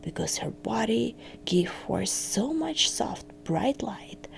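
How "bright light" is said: ordinary delivery, nothing marked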